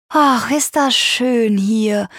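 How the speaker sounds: savouring it slowly; delighted exclamation. A narrator's reading. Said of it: "ruft Shira aus".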